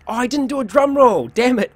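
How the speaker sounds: loud, high dismayed yelp; surprised outburst. Real, not acted. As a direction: blurt it out in sudden dismay. Realizes he forgot drum roll.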